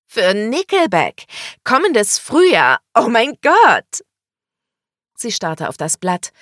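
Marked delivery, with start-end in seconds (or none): 0.1-4.0 high, loud, drawn-out exclamations; thrilled. A narrator's reading. Woman reads out her concert gift, thrilled. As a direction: squeal each new detail slowly, louder. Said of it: "Sie wedelte begeistert".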